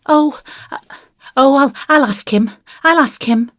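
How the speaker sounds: put-on high, stammering child's backtrack; flustered, hurried little-boy voice; quick, hasty. A narrator's reading. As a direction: hastily promise like an alarmed small boy. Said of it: "said Davy quickly".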